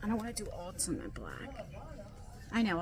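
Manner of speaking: normal talking voice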